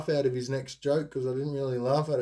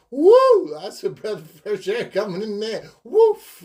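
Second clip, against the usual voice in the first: high, loud whooping exclaim, much higher and louder; gleeful whoop; 9 semitones higher, 10 dB louder than usual. Retold or imitated. Telling listeners about the menthol feeling, loud high whooping. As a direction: hoot with delight at the fresh rush. Imitating relief of a minty feeling.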